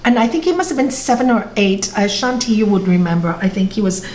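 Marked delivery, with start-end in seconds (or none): none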